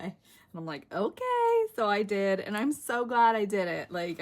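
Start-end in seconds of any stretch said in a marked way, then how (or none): 0.9-1.7 high-pitched drawn-out "okay", mock compliance; retold giving-in okay. Crafter retelling her reply to her friend, high giving-in okay.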